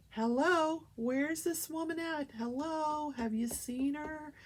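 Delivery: drawn-out "hello, anyone there?" call. Retold or imitated. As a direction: call out as if seeking someone inside. Acting out people calling at the door.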